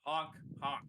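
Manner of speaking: high, loud honking clown; silly clown honk. Acted. Clown answers what H means.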